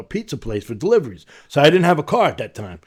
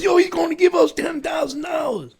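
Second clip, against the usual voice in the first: excited shout. Retold or imitated. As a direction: shout it up high like a kid who just hit the jackpot. Guy excited over ten grand.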